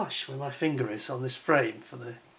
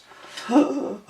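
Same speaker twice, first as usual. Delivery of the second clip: a sharp, high, hammed-up gasp; mock shocked gasp; 11 semitones higher, 4 dB louder than usual. Reviewer feigning horror at his own words, mock gasp. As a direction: gasp as if caught out, half joking.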